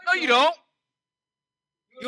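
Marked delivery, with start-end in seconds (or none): 0.1-0.5 a sharp loud no, higher and louder; loud rebuttal. Real, not acted. Preacher firmly answering a doubting congregation, loud. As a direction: shut the objection down hard.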